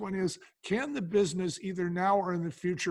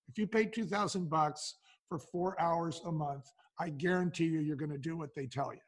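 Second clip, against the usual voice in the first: ordinary talk, nothing marked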